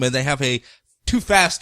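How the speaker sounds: ordinary talk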